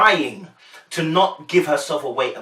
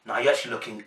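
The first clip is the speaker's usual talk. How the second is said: normal talking voice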